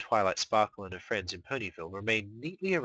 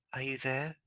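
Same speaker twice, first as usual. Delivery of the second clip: soft and unsure; hesitant call; 5 semitones higher, 5 dB quieter than usual. Acted. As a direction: ask into the darkness. Calling inward, hesitant.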